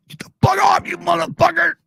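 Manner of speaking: very high, loud shouted insult; mock furious shout. The internet connection yelling at the streamer, a high furious shout. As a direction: yell it like an enraged voice. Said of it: "the fucking internet's telling me".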